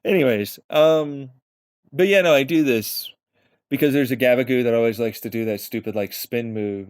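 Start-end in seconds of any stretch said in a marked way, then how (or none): none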